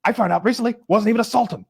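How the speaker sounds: high blustering boss voice; Steinbrenner impression. Owner ranting about Babe Ruth, high blustering voice. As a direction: rant like a pompous owner.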